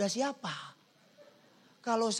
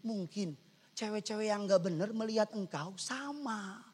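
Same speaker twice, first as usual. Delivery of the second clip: ordinary talk, nothing marked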